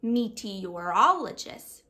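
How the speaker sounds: Meteorologist said slowly, every sound stretched; stretched out word. Acted. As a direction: pronounce Meteorologist syllable by syllable with a moving mouth. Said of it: "stretching out each sound".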